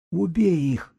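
high-pitched insistent repeat; high wheezy prompting; hoarse character's voice. A narrator's reading. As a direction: urge it in a high husky voice. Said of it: "повторил Сиплый".

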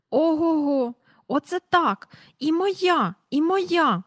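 put-on high, bright-eyed child squealing with joy; a child yelling as it floats up; excited child voice. Children's delight lifting off. (високий радісний дитячий вигук)

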